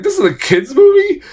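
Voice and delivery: high-pitched disbelief; incredulous question. Retold or imitated. Reviewer questioning violence in a kids film, incredulous. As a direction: ask it as if you can't believe it. Retelling shock at robot scene.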